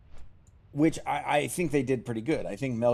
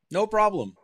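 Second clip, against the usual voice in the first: sudden high, louder "No problem."; hearty squeak; 10 semitones higher, 7 dB louder than usual. Acted. Dwarf boasting to the chat, high and loud. Dwarf punchline, pitch jump.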